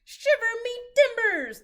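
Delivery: loud, high pirate cry; a startled old sea dog; pirate exclamation. A narrator's reading. Head pirate exclaims when lost, loud.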